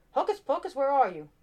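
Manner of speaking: high, loud call for the rabbit. A narrator's reading. Magician calls his rabbit.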